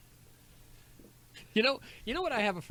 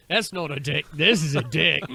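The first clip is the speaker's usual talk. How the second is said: mock Crocodile Dundee; 7 dB louder than usual. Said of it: "was he Australian".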